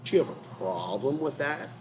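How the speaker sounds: menacing gangster challenge; a touch of creepy tough-guy menace. Retold or imitated. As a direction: lean in like a creepy thug daring them. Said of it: "Said in this creepy A-man voice".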